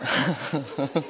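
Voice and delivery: low chuckle to oneself; quiet, low laugh after a joke; real laugh. Real, not acted. Preacher after his own email joke, low chuckle.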